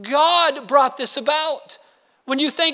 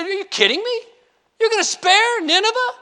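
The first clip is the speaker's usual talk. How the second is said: indignant Jonah; 9 semitones higher, faster than usual. Retold or imitated. Jonah angry God spares Nineveh.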